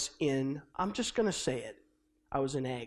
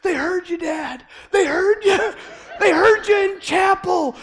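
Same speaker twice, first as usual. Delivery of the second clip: high, loud laughing shout; 12 semitones higher, 10 dB louder than usual. Retold or imitated. Son teasing his dad that the chapel heard him, laughing shout.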